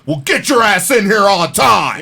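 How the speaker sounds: high, loud scolding bark; mock coach yell. Coach scolding Hawk for walking in at 1:59, loud mock yell. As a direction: snap at the late player like the coach.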